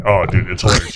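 ordinary talk, nothing marked

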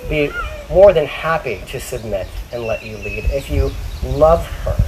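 usual speaking voice

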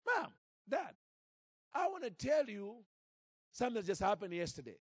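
put-on high, light child's voice calling Mom and Dad; little girl voice. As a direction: play the small daughter eagerly starting her news. Little Brooke speaks to parents.

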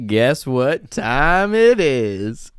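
slow drawn-out hype intro; hype announcer. Pretend. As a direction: draw it out like a show host.

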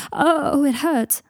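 on the edge of crying from the pain; near tears. Acted. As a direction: Draco crying out as the headache hits. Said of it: "sounded briefly as if he was going to cry".

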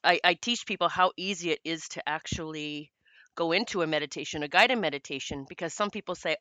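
ordinary talk, nothing marked

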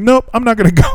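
put-on high, cheeky child refusal; stubborn kid. Teacher imitating a kid refusing to go to class, high cheeky voice. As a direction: laugh through it like a defiant little boy. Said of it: "And he's like".

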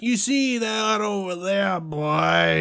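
put-on high, slow, syrupy Southern belle accent; Scarlett O'Hara. Showing off the old Southern accent, syrupy Southern drawl. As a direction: stretch it out in an old-style, saccharine Southern drawl. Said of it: "like Scarlett O'Hara".

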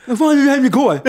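high, loud garbled wail; swollen-tongue panic. Retold or imitated. Pierced man asking his friend about yesterday, panicked garble. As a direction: wail it through a useless tongue. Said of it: "Så jag kan ju inte prata".

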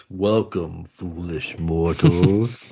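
slow, eerie spooky host voice; spooky ghost host. Pretend. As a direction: draw it out like a haunted mansion host. Quoting the Haunted Mansion ghost host.

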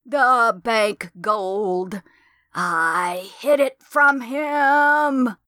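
high, very slow, otherworldly drawl; ghost voice. A narrator's reading. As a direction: let each word hang like a dead woman's voice. Ghost voice answers.

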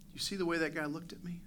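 fast, whispered suspicion; suspicious whisper. Retold or imitated. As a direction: whisper it like a paranoid thought. Voicing a suspicious thought.

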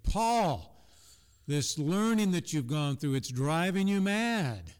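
loud, agitated. Retold or imitated. Festus cuts off Paul's defense, agitated and loud. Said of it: "he said in a loud voice, you know, probably very excited, very agitated".

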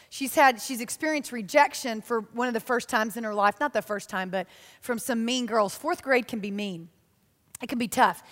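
ordinary talk

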